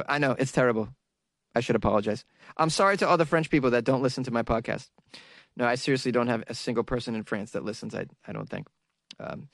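ordinary delivery

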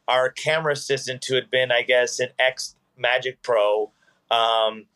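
ordinary delivery

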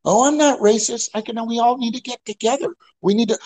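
sugary disconnected lady, lightly mocked; mock sweet lady. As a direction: ham up a fake-sweet lady.

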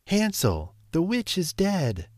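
put-on high, triumphant little girl's call; joyful cry. A narrator's reading. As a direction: shout the good news to her brother. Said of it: "cried Gretel".

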